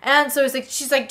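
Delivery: usual speaking voice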